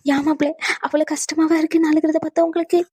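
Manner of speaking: high, whining sob-talk; mock tearful. Mother-in-law asks if her tears upset him, high fake weeping. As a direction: keep up the fake crying as you ask. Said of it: "நீங்க மட்டும் இனி அழுவாதீங்க".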